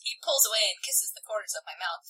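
hushed fanfic reading; mock erotic whisper. Host reading fanfic to co-host, hammed-up whisper. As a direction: whisper it like a steamy romance.

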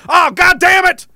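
loud, high-pitched outburst of frustration; hammed-up furious dismay; mock exasperated shout. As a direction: shout it high as a foiled raider. Ice team discovers it was tricked.

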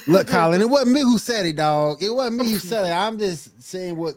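high-pitched protest of innocence; mock defensive pleading. Guest jokingly disowning a chat insult to Colin, mock pleading. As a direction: plead your case, voice high, half laughing.

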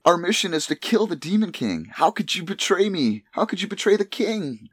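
high indignant hero voice; betrayed hero. Retold or imitated. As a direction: accuse him, high and hurt. Hero accusing his friend.

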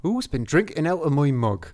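put-on West Country pirate-style accent; Taunton accent. Seventh dwarf asking about his mug, Taunton accent. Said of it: "just outside of Taunton".

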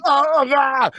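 high, loud mock cries; pained cries. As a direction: cry out in jerky pain. Imitating convulsing victim.

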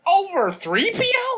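high, slow shocked question; incredulous. Real, not acted. Shocked at friend's choice.

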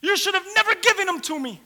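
high, anguished accusing cry; acted outburst of grief and anger; screaming at him. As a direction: plead and accuse like a bereaved mother. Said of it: "she was screaming at him".

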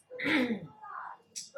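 high raspy throat clear. Real, not acted. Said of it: "so my throat is itchy".

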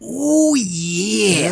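very high, drawn-out wrestler "ooh yeah"; a hammed-up Macho Man growl of approval; Randy Savage. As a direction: pro wrestler catchphrase voice. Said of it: "That was pretty good".

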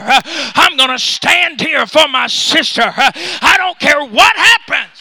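loud shouted sermon cadence; full-force preaching. Acted.

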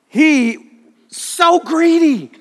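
high, slow "so greedy"; drawn out. Real, not acted. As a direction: stretch the words in mock disbelief. Pastor mocking son's greed.

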